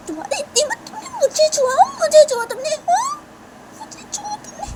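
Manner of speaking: shrieking high and loud in outrage; outraged shrieking; furious screaming. Acted. Shanaya rages at Tisha's apology.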